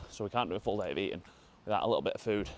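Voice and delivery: ordinary talk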